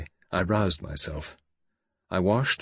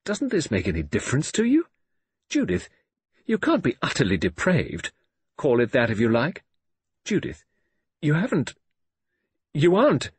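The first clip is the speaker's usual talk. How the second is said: appalled pleading; 7 semitones higher, 3 dB louder than usual. A narrator's reading. Father pleads with defiant daughter.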